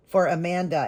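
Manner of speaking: normal talking voice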